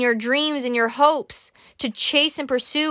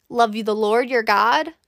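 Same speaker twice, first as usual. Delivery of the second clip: normal talking voice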